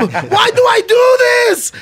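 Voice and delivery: mock-panicked fighter shouting his doubts; George St-Pierre impression. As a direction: act out George's fretting over his nerves, rising to a yell. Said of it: "Sound like him".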